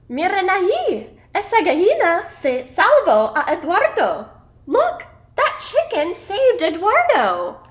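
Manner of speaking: high, excited character voice; squawky storybook exclamation; excited calling out. A narrator's reading. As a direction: call out high in amazement. Parrot cries out from above.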